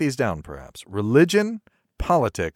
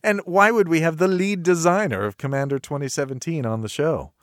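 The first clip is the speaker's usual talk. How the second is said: normal talking voice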